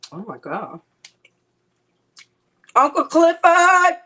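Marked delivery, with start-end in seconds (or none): 2.7-4.0 high, loud call. Real, not acted. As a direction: call out to someone far off. Calling out name.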